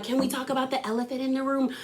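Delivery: hushed, quick secretive murmur; mock whisper. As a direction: imitate someone whispering gossip at the table. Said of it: "Whispering to Melissa".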